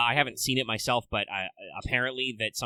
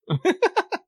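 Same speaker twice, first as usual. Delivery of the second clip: high laugh; 8 dB louder than usual. Real, not acted. Laughing at server shuffling.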